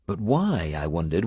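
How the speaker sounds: usual speaking voice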